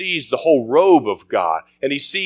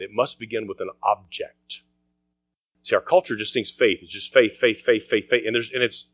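ordinary talk

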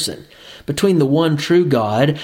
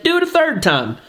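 much higher-pitched insistent order; emphatic command; 13 semitones higher than usual. A narrator's reading. Elijah orders water poured on the offering, emphatic command.

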